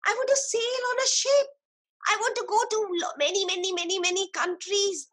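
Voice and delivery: high, dreamy wishing voice; eager boy. A narrator's reading. Boy tells the tree his dream, high and eager. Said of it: "the boy said".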